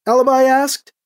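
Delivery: ordinary delivery, nothing marked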